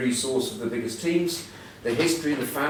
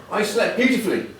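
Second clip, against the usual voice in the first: usual speaking voice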